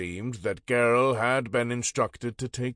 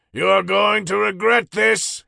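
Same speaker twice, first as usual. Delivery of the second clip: furious yell, much higher and louder; yelled threat; 10 semitones higher, 9 dB louder than usual. A narrator's reading. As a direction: shout it after her as she leaves. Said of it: "he yelled after her".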